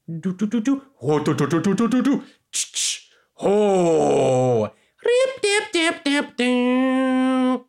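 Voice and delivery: quick, high bouncy vocal noises; beatbox-style rising chirps; mouth sound effects. Pretend. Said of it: "Now I'm going to explain more of the mechanics".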